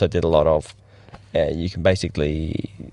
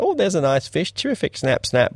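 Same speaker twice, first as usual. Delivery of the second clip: much higher, breezy mock delight at a nice fish; mock cheery tourist; 8 semitones higher than usual. Snorkeller mocking holiday fun, chirpy and high. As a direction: play a carefree holiday snorkeller, pitched way up.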